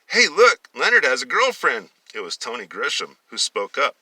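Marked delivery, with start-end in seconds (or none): 0.1-1.9 high, loud taunt; teasing call. A narrator's reading. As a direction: call it out to make fun of him. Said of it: "Tony and Bill were teasing Leonard".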